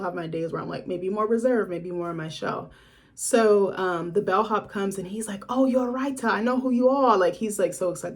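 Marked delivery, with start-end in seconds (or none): 5.5-7.2 put-on a quick, raised, eager Aussie accent. Excited bellhop greets the famous writer, in a light Aussie accent. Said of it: "That's, like, an Aussie accent".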